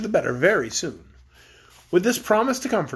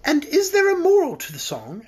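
high-pitched, imperious queen's question; 10 semitones higher than usual. A narrator's reading. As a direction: query the performer as an amused queen. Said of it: "asked Queen Cor".

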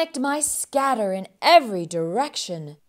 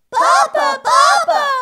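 high, loud squeal of joyful mice; little mice squealing for papa; 12 dB louder than usual. Acted. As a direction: squeal it out with delight.

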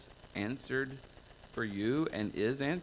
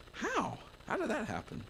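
How? asked much higher, softer, in amazement; wondering question; 10 semitones higher, 6 dB quieter than usual. Acted. Preacher asking the congregation in wonder, high and soft. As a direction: marvel aloud at it.

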